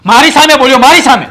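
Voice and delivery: angry outburst. Acted. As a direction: bristle at being talked back to. Father angry at son's backtalk.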